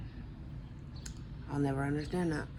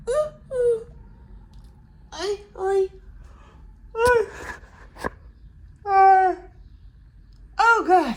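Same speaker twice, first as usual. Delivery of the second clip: stretching groans; 11 semitones higher, 4 dB louder than usual. Real, not acted. Stretching after waking up.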